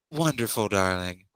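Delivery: ordinary talk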